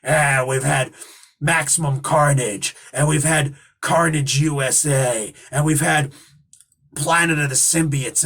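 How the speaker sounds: gruff wrestling boss voice; Vince McMahon voice. Retold or imitated. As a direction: growl it like a wrestling promoter. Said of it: "He reminds me of Vince McMahon".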